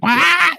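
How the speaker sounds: wide-open bawl like Charlie Brown; hammed-up cartoon kid crying; mock crying wail. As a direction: imitate a kid's open-mouthed wail. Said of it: "Look at this thing".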